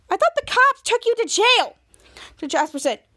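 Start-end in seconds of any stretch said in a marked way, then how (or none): 0.1-1.8 much higher and louder, startled disbelief; shocked Piper. Retold or imitated. Piper to Jasper, much higher in shocked disbelief. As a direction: she can't believe he's out.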